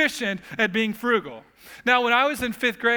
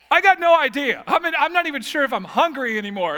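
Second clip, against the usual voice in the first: put-on flustered kid; 7 semitones higher, 5 dB louder than usual. Boy stunned by dad's question.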